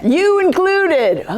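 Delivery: high, drawn-out okay; emphatic quoted okay. Retold or imitated. Speaker retelling her reply to the group, emphatic. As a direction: stretch it out high, a pointed aside. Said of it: "I'm like".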